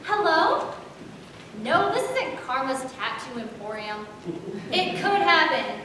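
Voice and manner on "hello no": ordinary talk, nothing marked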